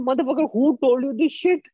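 snapping at him in disbelief; exasperated retort. Retold or imitated. To the friend bashing women, high exasperated scolding. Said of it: "I said".

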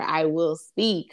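usual speaking voice

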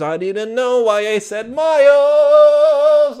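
rising high, loud, drawn-out self-reproach; exasperated mock yell. Host scolds himself for using miles, exasperated comic yell. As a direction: wail the last word loudly and stretch it. Exasperated at his own slip.

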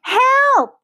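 very high, loud cry for help; frightened cry. A narrator's reading. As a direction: cry out in panic, right at the top. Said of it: "she cried".

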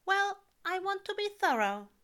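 high, loud fussy tut; tutting. A narrator's reading. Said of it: "Aziraphale tuts".

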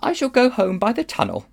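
put-on high, haughty girl's voice; rather coldly. A narrator's reading. Polly answers Diggory's plea to stay, rather coldly. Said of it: "rather coldly".

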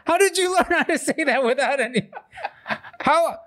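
very high, laughing mock fury; mock outrage through laughter. Dad mock-angry at a clever toddler, laughing high. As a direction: squeal it in fake anger while cracking up. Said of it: "I was like".

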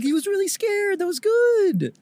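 bubbling over with pride; giddy mock voice. Mocking giddy guy.

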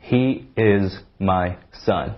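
he is my son very slowly; slow drill. Shown as a demonstration. Teacher modelling the sentence slowly. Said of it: "我们来练几个".

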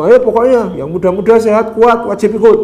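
put-on high, loud call to the young men; rally them like a drill sergeant; barking recruiter. Army recruiter calling out. (perekrut tentara berseru lantang)

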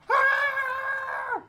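high piercing startled scream. Retold or imitated. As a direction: shriek like you're startled. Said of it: "Just go screaming".

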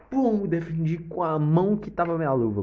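loud, high burst of excitement; excited. Retold or imitated. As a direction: shout the save like it just happened. Excited climax of penalty save.

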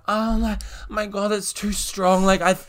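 mock drunk. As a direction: overplay being tipsy on a fake drink. Retelling her own fake-drunk act.

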